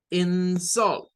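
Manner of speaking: "insult" as in-SULT, the second syllable strong; teacher's careful "insult", weight on "-sult"; stress on second syllable. Shown as a demonstration. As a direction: punch the end of "insult" like a verb. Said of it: "la sílaba tónica es la segunda".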